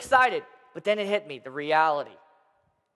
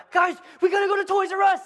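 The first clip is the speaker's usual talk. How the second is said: put-on high, loud excited child shout; imitating an impatient little boy; urgent kid; 12 semitones higher, 4 dB louder, faster than usual. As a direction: wake the whole house. Child waking parents at four.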